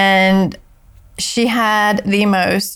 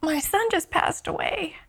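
higher, breaking voice with tears; tearful, near crying; 8 semitones higher than usual. Real, not acted. Telling about her son's death, choked up and tearful. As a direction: fight back tears as you tell it. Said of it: "I just started to cry".

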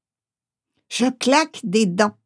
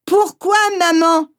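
put-on high pleading questions from a drenched child; shivering plea; 5 semitones higher than usual. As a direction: beg her mother, shivering with cold. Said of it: "J'implore en grelottant".

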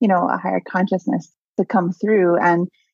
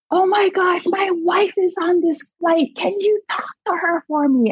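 excited urgent plea; 11 semitones higher than usual. Retold or imitated. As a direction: imitate someone desperate for a favour, pitched high. Dead husband begging a favour.

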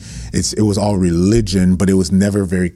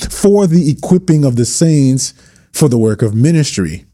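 rising impassioned preaching; full rant mode; 5 semitones higher than usual. Real, not acted. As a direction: preach it with building fire. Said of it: "I'm in full rant mode".

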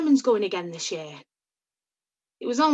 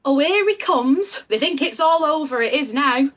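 loud, higher commentator call; mock match commentary, loud; football commentator voice; 7 semitones higher, 6 dB louder than usual. Mocking commentary as he arrives.

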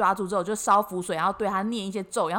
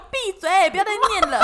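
loud, high, laughing mock ghost yell; a ghost telling her to shut up, half laughing; 9 semitones higher, 5 dB louder than usual. Qipao ghost scolding her chanting, loud laughing mock yell.

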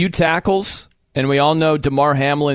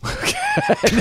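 a sudden laugh jumping very high; shrill real laugh breaking out; high squeal laugh. Real, not acted. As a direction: burst out in a squeaky giggle. Laughing at a Bills highlight.

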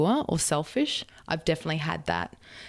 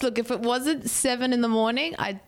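normal talking voice

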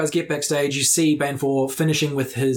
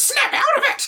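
snap out of it. Acted. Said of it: "shakes you and goes".

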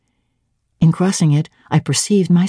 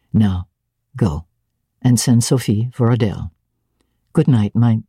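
stern master, lower pitch, commanding; lower Rochester voice; 5 semitones lower than usual. Acted. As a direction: speak lower as the master sending her away.

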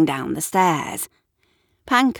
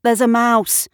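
loud, higher-pitched announcement; calling out; 6 semitones higher, 6 dB louder than usual. A narrator's reading. Gavin explains the chaos in the hall, calling out. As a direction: raise your voice over the chaos.

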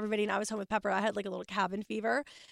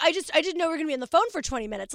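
annoyed rushed husband; 5 semitones higher, 5 dB louder, faster than usual. Retold or imitated. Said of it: "He's like".

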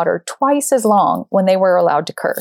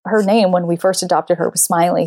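normal talking voice

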